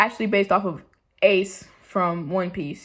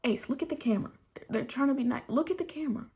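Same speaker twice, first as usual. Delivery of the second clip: higher, softer coaxing voice; coaxing the dog; 5 semitones higher, 7 dB quieter, faster than usual. Real, not acted. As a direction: gently coax the dog to look. Coaxing dog to look at camera.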